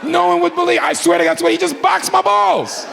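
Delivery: scandalized high-pitched protest; outraged disbelief. Acted. Outraged climax of the pat-down story.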